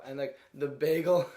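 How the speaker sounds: ordinary talk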